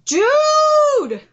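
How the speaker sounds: high, loud shout of dude; excited shout. Real, not acted. Streamer hyped by the fight, shouting. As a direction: yell it at the screen.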